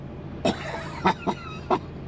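breathy high-pitched giggle; squeaky delighted giggle; high unvoiced giggle. Real, not acted. Delighted at free gift.

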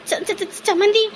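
stammering, caught-out reply; flustered stammer. Acted. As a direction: stutter on the first sound. Flustered by the angry in-law.

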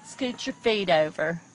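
ordinary talk, nothing marked

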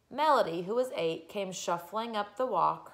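plain reading voice

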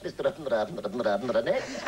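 drawn-out gabble in place of a name; mumbled unknown name. Retold or imitated. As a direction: fudge the name with polite nonsense syllables. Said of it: "she didn't want, know what it was".

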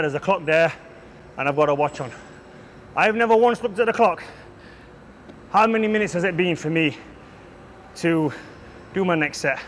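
ordinary talk

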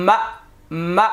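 মা cut short with a light glottal catch; slight push. Shown as a demonstration. Teacher drilling mim hamza zabar, slight push on the stop. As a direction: give each মা a small jolt at the end. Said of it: "হালকা একটু ধাক্কা হবে".

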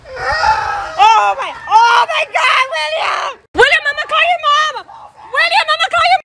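high, panicky yelling; panicked shout. Acted. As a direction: call out in alarm. Panicking as William is stuck.

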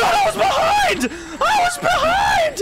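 yelling at the game that it's unfair; outraged shout. Real, not acted. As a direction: protest at the top of your voice. Furious protest at game.

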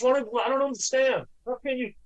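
lightly mocking high caller voice. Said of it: "He goes".

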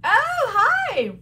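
high, loud excited hello; excited greeting. Real, not acted. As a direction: squeal a bright hello. Greeting viewer warmly.

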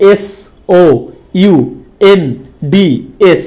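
each letter on its own, pauses between; spelled letter by letter. Shown as a demonstration. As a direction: spell it out, isolating every letter. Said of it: "আলাদা আলাদাভাবে spelling করেছি".

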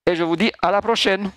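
ordinary talk

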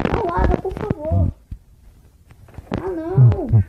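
high, loud begging; desperate pleading. Real, not acted. Game about to disconnect.

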